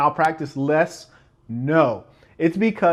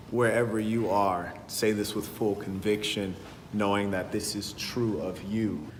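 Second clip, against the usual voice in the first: solemn creed; 5 semitones lower, 4 dB quieter than usual. Acted. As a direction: lead the room in a sacred pledge. Leads congregation in a creed.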